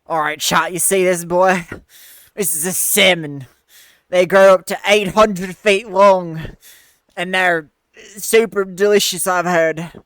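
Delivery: loud showy nature host; presenter voice. Pretend. Presents fish to chat.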